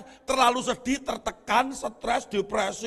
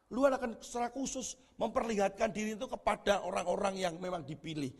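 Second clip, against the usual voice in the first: ordinary talk, nothing marked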